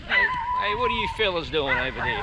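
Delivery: loud call to dogs across the road. Real, not acted. Cyclist calls out to dogs by the road, loud and friendly. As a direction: hail the dogs over there.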